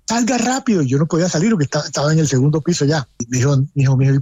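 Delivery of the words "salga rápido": urgent call, pitched well up; retold shout. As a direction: imitate a son urging his father out. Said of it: "me gritaba".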